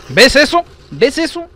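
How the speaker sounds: high, loud rising questions; outraged shout. Real, not acted. Gamer outraged at hidden enemies, high exasperated shout. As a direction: demand it in sharp disbelief.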